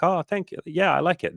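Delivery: high, pleased little imitation; friendly acceptance. Imagined woman thanking a man for his compliment, friendly. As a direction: voice a receptive reply in a lifted pitch. Acting a receptive woman's reply.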